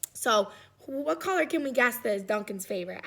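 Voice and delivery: plain reading voice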